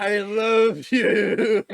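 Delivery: slow, loud, high declaration; loud joking shout. Acted. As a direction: bellow it out playfully. Joking shout at sexy dwarf.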